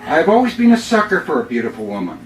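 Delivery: upbeat poem read with comic timing; humorous poem reading. Acted. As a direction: deliver the punchlines with a grin. Reads his humorous poem.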